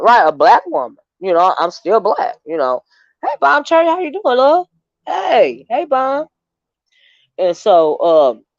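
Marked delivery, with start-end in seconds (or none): 3.2-6.3 bright high greeting. Real, not acted. Greeting a viewer in chat.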